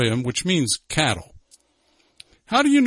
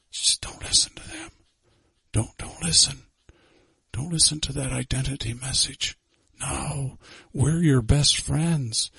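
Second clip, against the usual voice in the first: whispering tempter; 5 dB quieter than usual. Acted. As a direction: lure her in a hushed whisper. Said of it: "hissing in Eve's ear".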